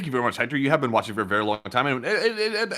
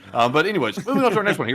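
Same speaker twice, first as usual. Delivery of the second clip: normal talking voice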